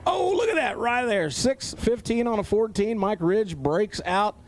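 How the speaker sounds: excited high-pitched finish call; live race call. Real, not acted. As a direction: burst out high, then stretch the result.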